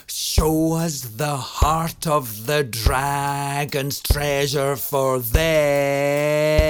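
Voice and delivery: "then" held long, stretched over seven beats; slow line, hold "then" for seven beats; held note on then. Shown as a demonstration. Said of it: "you hold the word then for seven beats".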